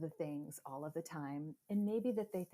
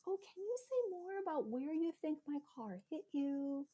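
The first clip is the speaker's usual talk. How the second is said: much higher, softer, sugary placating voice; sweet and quiet; 10 semitones higher than usual. Retold or imitated. Mom retelling how she calmed the angry driver, sweet and quiet. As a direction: sweeten it right up to defuse her. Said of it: "I just got really, really sweet and really quiet".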